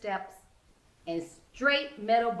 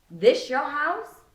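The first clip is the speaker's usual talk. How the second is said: skeptical friend; 5 semitones higher, 6 dB louder, slower than usual. A narrator's reading. Regina questions the yard furniture.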